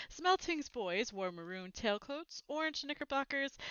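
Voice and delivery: usual speaking voice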